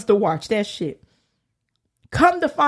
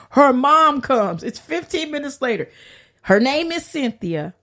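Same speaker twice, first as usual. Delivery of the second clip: ordinary talk, nothing marked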